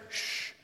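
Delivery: soft breathy shh; a quick soft shush for quiet. Shown as a demonstration. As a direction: hush them. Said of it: "You don't go".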